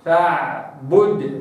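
slow recited فاعبده with a clear dal; tajweed recitation. Shown as a demonstration. Teacher recites فاعبده slowly for students. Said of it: "هكذا".

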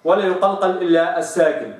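ordinary talk

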